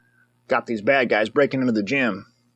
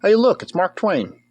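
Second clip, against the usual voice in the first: normal talking voice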